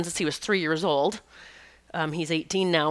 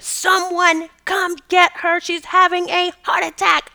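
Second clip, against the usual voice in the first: high, loud frantic yell for help; retold scream; 11 semitones higher, 4 dB louder than usual. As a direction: scream it across the hospital lobby. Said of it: "I screamed".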